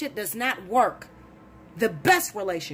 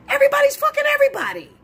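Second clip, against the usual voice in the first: higher, louder and fast; exasperated outburst; 6 dB louder, faster than usual. Real, not acted. Ranting to listeners about salon cheating, loud and fast. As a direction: blurt it out in disbelief.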